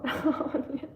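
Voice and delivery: short breathy laugh while tearing up; a real little laugh with wet eyes; teary laugh. Real, not acted. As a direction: laugh through onion tears. Teary laugh over onion tears.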